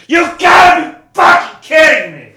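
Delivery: high, slow, shrieking yell; Jason scream. Retold or imitated. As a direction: scream it in total disbelief. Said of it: "A hundred percent was".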